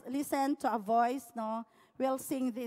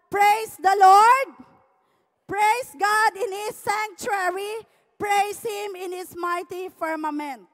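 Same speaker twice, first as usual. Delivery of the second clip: scripture reading; 4 semitones higher, 8 dB louder, slower than usual. Acted. Reads psalm aloud.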